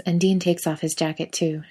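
usual speaking voice

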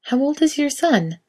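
cheerful shop assistant's question; bright saleswoman. A narrator's reading. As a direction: ask brightly. Elle voiced.

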